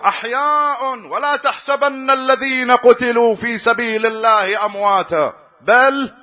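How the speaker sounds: loud, high and slow recitation; preacher crying out the Quranic verse; proclaiming verse. Acted.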